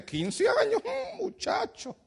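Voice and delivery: high, soft mocking voice with a laugh. People mocking church member. (burla aguda y suave entre risas)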